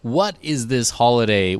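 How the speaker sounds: ordinary delivery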